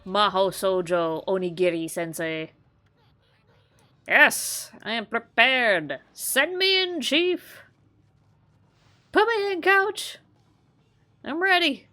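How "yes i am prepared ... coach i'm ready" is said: much higher, eager and cheery; a lightly voiced eager magical girl; bright magical girl. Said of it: "Embrace the magical girl transformation".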